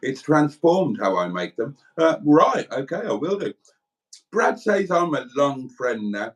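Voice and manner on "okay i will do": ordinary talk, nothing marked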